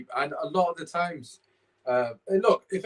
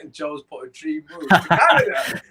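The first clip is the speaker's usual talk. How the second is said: loud laughing outburst; 3 semitones higher, 8 dB louder than usual. Real, not acted. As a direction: shout it through laughter. Banter over a viewer comment.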